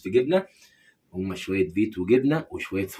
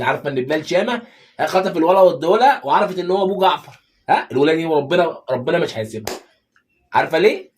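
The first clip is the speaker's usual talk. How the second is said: higher, louder, building worked-up rant; 5 semitones higher, 6 dB louder than usual. Acted. Husband to wife about the drama villain, heated excited rant. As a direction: get carried away retelling the plot.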